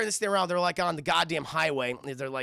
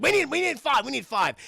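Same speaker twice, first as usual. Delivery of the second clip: raised, louder acted order; mock urgent demand; 9 semitones higher, 7 dB louder than usual. Angry cop clearing a room, raised urgent voice.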